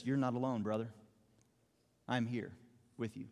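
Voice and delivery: soft, low, tender reassurance; encouraging. Acted. Ananias comforts lonely, blinded Saul, softly encouraging. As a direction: comfort gently, leaving space between phrases. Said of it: "How encouraging that would have been".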